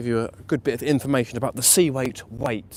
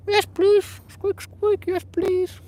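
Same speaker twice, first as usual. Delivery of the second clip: tiny cartoon rat, much higher pitched; squeaky rat voice; much slower than usual. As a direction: squeak it out like a grateful little rat. Voicing the rat answering him.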